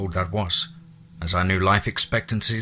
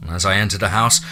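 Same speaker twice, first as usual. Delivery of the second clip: ordinary delivery, nothing marked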